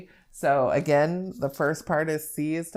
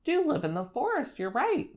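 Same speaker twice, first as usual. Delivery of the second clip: put-on bright, higher praise for a child's answer; modelled praise; 10 semitones higher than usual. Shown as a demonstration. Teacher praising a child's guess, encouraging. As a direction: reward the child's answer eagerly. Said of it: "I'll be like".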